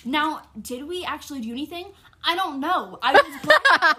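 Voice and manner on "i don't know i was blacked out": laughing. Real, not acted. Laughing at own story.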